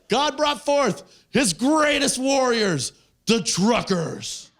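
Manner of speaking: slow, high-pitched mock proclamation; grand proclamation. Comic reading a protest sign aloud, mock-grand proclamation. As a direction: declaim it slowly like holy scripture.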